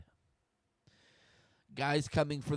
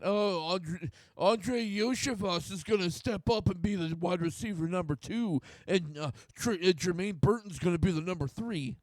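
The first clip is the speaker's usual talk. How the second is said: higher, louder mock voice of a doubting fan; mocking the critics; 6 semitones higher, 4 dB louder than usual. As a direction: parrot the critics' argument in a silly higher voice. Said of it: "if you're gonna make the argument that".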